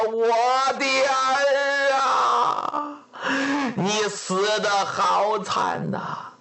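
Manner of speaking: slow, drawn-out wailing for a lost daughter; a father keening over his murdered child; wailing lament. Acted. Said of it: "一缓过来气儿是放声痛哭啊".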